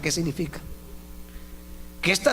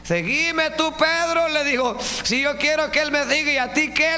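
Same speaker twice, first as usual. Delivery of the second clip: preached dialogue; 10 semitones higher than usual. Acted. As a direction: act the Lord's reply with force. Dialogue of Jesus and Pedro preached.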